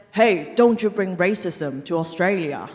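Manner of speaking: ordinary talk, nothing marked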